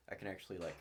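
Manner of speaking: ordinary talk, nothing marked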